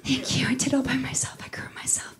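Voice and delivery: soft unvoiced brag; whispered aside. Real, not acted. Comic bragging to the crowd in a whisper. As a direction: whisper it to the front row.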